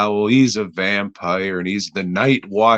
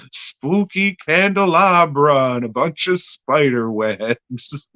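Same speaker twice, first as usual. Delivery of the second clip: mock spooky; 6 semitones higher than usual. Hams up spooky props, then laughs.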